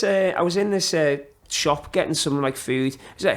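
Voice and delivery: ordinary talk, nothing marked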